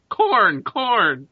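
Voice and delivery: high, loud crow caw; cawing raven. Acted. Next crow along calls for food, loud cawing. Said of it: "one next to it goes".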